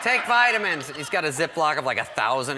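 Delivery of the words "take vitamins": high, loud insistent order; pushy vitamin peddler. Retold or imitated. Rob pushing vitamins on bandmates, mock-insistent. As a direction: press the pills on them. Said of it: "he's like".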